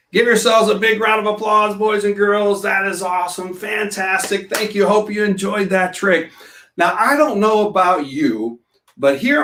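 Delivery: bright, upbeat show host voice; kids party magician. Acted. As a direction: hype up the kids in the audience. Magician hyping kids' audience.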